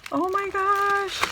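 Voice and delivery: gushing awe. Real, not acted. Gushing over handmade happy mail.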